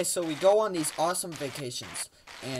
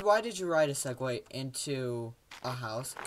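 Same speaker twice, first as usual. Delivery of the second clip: ordinary talk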